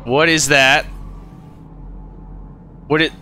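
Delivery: normal talking voice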